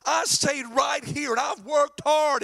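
aggrieved son crying unfair; mad older son. Acted. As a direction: insist on your loyalty, indignantly. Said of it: "And the son's mad".

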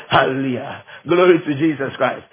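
ordinary talk

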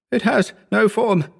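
high, babbling panic; words tumbling out in terror; gibbering. A narrator's reading. Howard insisting to the narrator, high gibbering panic. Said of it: "gibbered Howard".

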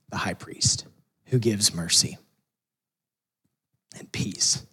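soft unvoiced whisper; hushed whisper. Real, not acted. Preacher closing on the writer's words, hushed whisper. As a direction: breathe the blessing out, hushed.

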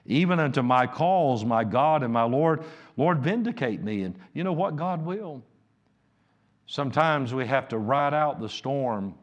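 high, loud pulpit preaching; fiery sermon delivery; full-force preaching. Acted.

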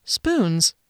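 Spoons. stated flatly, falling at the end; deadpan Spoons. with a dropping tune; flat statement. Acted. Narrator's flat answer.

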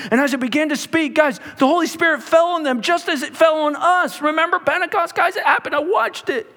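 a preacher lifting the room; rising preaching. Acted. Preacher building to a climax.

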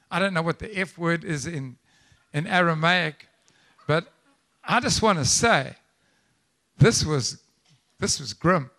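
ordinary talk, nothing marked

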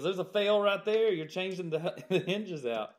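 teasing inspector. Retold or imitated. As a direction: catch him out, half joking. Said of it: "like he's just joking with me".

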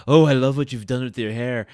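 mock lover's murmuring, voiced out loud. Man in eye mask to supposed bedmate, mock amorous. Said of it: "has a, the stereotypical".

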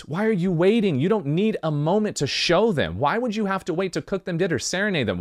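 ordinary delivery

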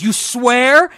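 hammed-up shocked question; mock incredulous guy. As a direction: ask it like you can't believe him. Guy's question in joke retold.

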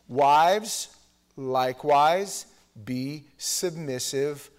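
loud, slow, emphatic read; scripture reading. Acted. Preacher rereading the verse to the church, slow and weighty. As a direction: read it slowly, landing each word.

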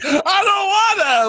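put-on crying, slow whiny child voice; whiny kid. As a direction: whine like a tired kid. Kid whining on vacation.

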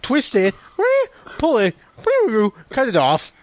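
game commands broken by high-pitched buzzy noises; mock game-toy commands with sound effects; imitated toy announcer. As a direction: mimic an electronic toy calling out moves.

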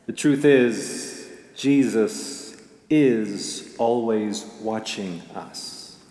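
slow, hushed and earnest; slow serious turn. Real, not acted. Pastor turning from joke to message, slow and hushed. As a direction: let the truth land slowly. Punchline turns to serious point.

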